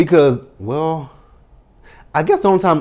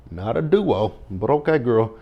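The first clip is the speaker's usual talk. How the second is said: ordinary talk, nothing marked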